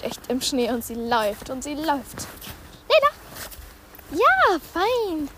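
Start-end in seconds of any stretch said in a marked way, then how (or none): 2.9-3.1 loud call across the snow; calling the dog. Real, not acted. 4.1-5.3 high, bright dog praise; praising the dog. Real, not acted.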